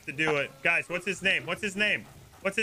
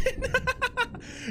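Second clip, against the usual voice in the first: high gleeful laugh. Real, not acted. Cop laughing that the captain is jailed, high gleeful laugh. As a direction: burst out in a high delighted laugh.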